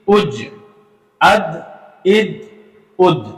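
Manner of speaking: each closing letter bounced with an echo; clipped syllables, final consonant rebounding; slow recited drill, each syllable set apart; qalqala drill. Shown as a demonstration. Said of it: "हम इसको बाकायदा तौर पे पढ़ के देखते हैं".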